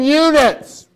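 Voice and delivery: loud, high burst ending the whisper; sudden shout. Real, not acted. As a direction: blurt the last word out loud. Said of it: "Oh, that's not necessarily part of it".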